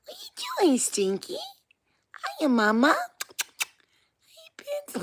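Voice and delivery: very high, squeaky pet-cooing. Real, not acted. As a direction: coo at a beloved pet in a tiny high voice. Baby-talking to a pet.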